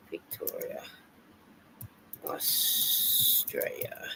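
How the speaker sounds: whispered aside while typing, "Victoria, Australia" breathed out; whisper to herself. Real, not acted. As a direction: murmur the words as you type them. Typing a place name.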